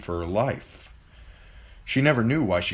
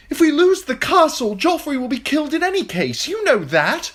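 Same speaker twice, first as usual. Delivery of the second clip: high, loud desperate plea; wounded knight begging the queen; desperate pleading; 7 dB louder than usual. A narrator's reading. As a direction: beg her at the top of his voice. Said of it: "Sir Lancel pleaded".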